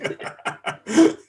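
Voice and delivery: high breathy laugh. Real, not acted. Burst of laughter.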